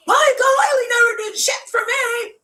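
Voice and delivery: high screechy mock yell; top-of-lungs screaming. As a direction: imitate a furious scream down the phone. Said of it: "Jill screaming at the top of her lungs".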